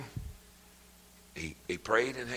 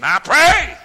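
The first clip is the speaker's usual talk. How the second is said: high, loud pleading cry of the rich man; preacher wailing the rich man's plea; preaching at full force; 15 dB louder than usual. Acted. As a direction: cry it up as a man begging from hell. Voicing the rich man in hell.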